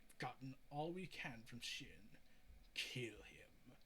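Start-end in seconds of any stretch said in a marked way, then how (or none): 2.7-3.7 a cold unvoiced whisper; villain whisper. Villain to his men, a cold whisper. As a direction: breathe the order out with no voice. Villain orders Shin killed.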